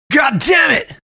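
high, loud outburst of frustration; sudden shout. A narrator's reading. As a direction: blurt it out as a yell. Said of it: "shouted out of nowhere".

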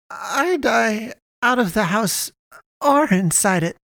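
slow, high-pitched, defiant mother; resolute mother. Acted. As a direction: declare it with stubborn dignity. Old mother answers her son.

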